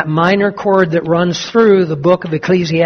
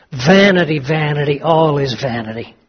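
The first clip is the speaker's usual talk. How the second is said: gloomy refrain; 4 semitones lower than usual. Acted. Reading gloomy Ecclesiastes refrain.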